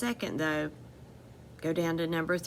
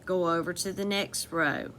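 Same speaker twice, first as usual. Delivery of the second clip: usual speaking voice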